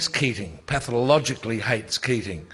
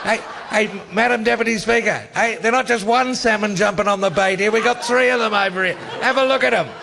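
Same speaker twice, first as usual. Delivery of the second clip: higher and louder mocking parliamentary jeer; imitating Keating; 10 semitones higher, 5 dB louder than usual. Telling the panel how Keating taunted Downer, mocking jeer. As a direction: ham up a gloating Keating taunt. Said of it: "they cut back to Keating".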